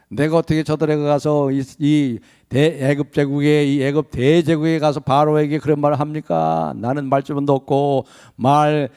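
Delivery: usual speaking voice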